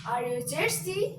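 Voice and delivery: "Are you thirsty?" with pitch climbing to the end; rising intonation. Shown as a demonstration. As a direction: lift "thirsty" as a yes-or-no question. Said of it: "Raising intonation is common in yes".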